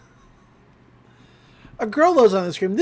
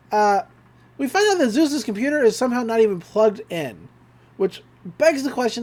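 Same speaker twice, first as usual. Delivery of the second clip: ordinary delivery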